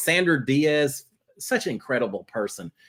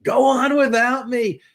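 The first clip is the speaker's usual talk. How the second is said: over-the-top noble sacrifice, pitched way up; melodramatic farewell; 4 semitones higher than usual. Retold or imitated. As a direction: play the dying hero sending her off. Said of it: "trying to get her all fired up".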